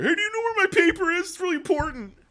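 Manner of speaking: mock the fretting princess in a squeaky voice; a hammed-up high-pitched impression of a frantic princess; Princess Cherry. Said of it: "annoying Princess Cherry".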